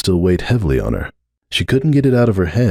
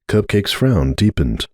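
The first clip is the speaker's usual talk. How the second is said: ordinary delivery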